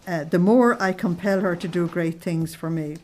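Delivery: ordinary delivery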